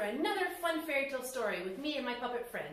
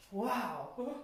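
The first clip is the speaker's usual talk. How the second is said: hushed gasped wow; awed gasp and wow; 5 dB quieter than usual. Acted. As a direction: breathe it out in awe. Awed logger sees majestic man.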